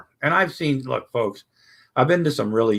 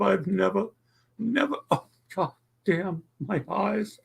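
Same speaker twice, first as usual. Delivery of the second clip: choked up; 9 semitones higher than usual. Real, not acted. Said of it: "I promised I wouldn't cry again".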